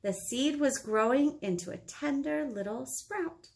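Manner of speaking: ordinary delivery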